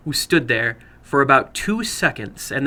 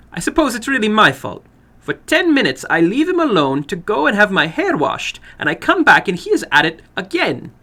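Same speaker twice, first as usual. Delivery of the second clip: higher, louder woman's voice, exasperated; exasperated older woman; 8 semitones higher, 5 dB louder than usual. Acted. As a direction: scold the situation with weary blame.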